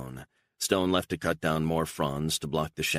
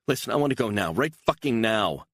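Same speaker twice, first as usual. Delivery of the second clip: higher and louder, insisting; angry demand; 7 semitones higher, 5 dB louder than usual. Acted. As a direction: plant your feet and demand it. Beatrice demands to leave now.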